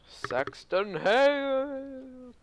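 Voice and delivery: drawn-out, high announcer shout; announcer call. Pretend. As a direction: stretch the name like a boxing announcer. Dramatic call of boss name.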